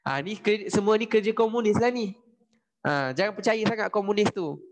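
acted finger-pointing to villagers; British blaming the communists. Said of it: "Dia cakap dekat rakyat".

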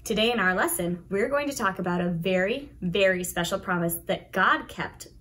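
plain reading voice

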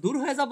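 high-pitched দূর হয়ে যাও; loud high climb. Shown as a demonstration. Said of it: "অনেক জোরে বলছেন".

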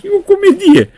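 high, loud mock exclamation; a hammed-up, high, loud "what a farce"; mocking CIA director. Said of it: "și zice". (directorul CIA imitat batjocoritor, sus și tare)

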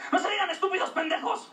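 high mock mother scolding; mom scolding.

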